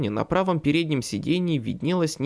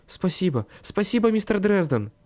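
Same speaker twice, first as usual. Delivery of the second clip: breathless thanks; 6 semitones higher than usual. Acted. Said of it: "прерывисто вздохнула".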